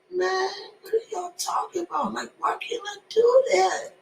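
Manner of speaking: high, incredulous question; baffled. Retold or imitated. Rapper answering the critics, high baffled disbelief. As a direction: act totally baffled. Retelling his baffled reply.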